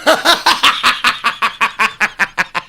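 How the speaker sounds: long high, loud laughing fit. Real, not acted. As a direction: laugh loudly, tailing down gradually. Laughing at the pickup line.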